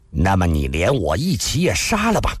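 ordinary delivery, nothing marked

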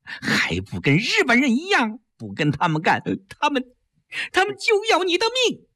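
high, sharp scolding; scornful scolding. Acted. Wife scolding husband for joining them, high and scornful. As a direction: spit the blame back at him. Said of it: "季嫣然啐了他一口".

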